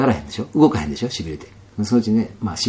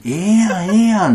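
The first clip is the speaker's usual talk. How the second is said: higher, louder Kansai star voice; stars in Kansai dialect; 11 semitones higher, 7 dB louder than usual. Retold or imitated. Stars telling the fallen man he is fine as he is, high and loud. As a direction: let the stars cheer him on. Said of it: "関西弁やん".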